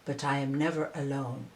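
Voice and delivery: low, soft reply; answered quietly. Acted. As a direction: say it quietly, almost to himself. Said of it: "Qiu Yuan answered quietly".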